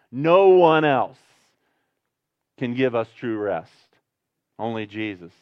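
ordinary delivery

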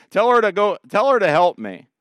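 demanding command. Retold or imitated. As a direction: order him about, hands on hips. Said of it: "she proceeds to command Jesus".

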